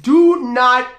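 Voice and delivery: loud, forceful warning; acting out a scolding; stern retold rebuke. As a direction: cut him off firmly.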